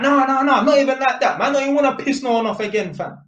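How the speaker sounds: loud, high, fast "no, no, no" backpedal; hasty denial. Retold or imitated. Reassuring the wary dad he never messed with his daughter, loud and rushed. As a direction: scramble to reassure him, quickly and loudly. Hastily denying to suspicious dad.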